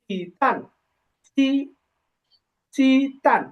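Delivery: চি তান in separated syllables, তান ending in n; pronunciation demo. Shown as a demonstration. Teacher giving the student the correct word, pronunciation demo of চি তান. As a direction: model চি, then চি তান, with pauses. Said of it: "আর এটা হবে যে".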